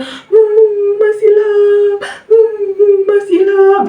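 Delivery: high whimpering sobs around the name; possessed crying. Retold or imitated. Possessed wife still weeping, calling Ilham in high sobs. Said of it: "Si Dewi masih nangis".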